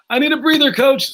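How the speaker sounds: high, pleading mock of a winded player begging the coach; whiny tired player. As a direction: beg the coach for a break, voice up high.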